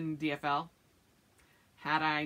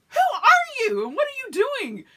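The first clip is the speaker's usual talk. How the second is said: much higher and louder, half-laughing disbelief; mock alarmed outcry; 10 dB louder than usual. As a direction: call after a husband who just took off running. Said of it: "I'm like".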